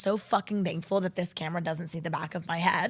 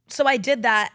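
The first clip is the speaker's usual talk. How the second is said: punchy admission; 4 semitones higher, 7 dB louder than usual. Real, not acted. Loud punchline between whispers.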